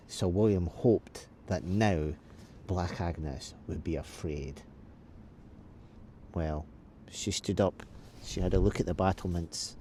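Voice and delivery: ordinary delivery, nothing marked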